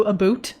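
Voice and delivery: "a boot" said the Canadian way, about rhymed with boot. Shown as a demonstration. Streamer showing chat her Canadian "a boot". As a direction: let "a boot" slip out with a Canadian vowel. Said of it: "I pronounce them a bit more like a Canadian".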